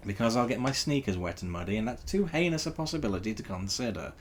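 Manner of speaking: ordinary delivery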